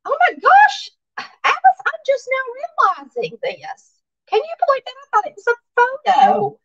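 very high, amazed squeal of discovery; excited, high-pitched voice. Real, not acted. Host just realizing the card is cross-stitched, excited high-pitched voice. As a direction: let your voice shoot up in real excitement. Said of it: "I got the most high-pitched voice sometimes".

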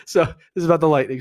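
recovering from a real laugh. Real, not acted. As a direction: talk on through the tail of a laugh. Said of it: "I had to laugh there".

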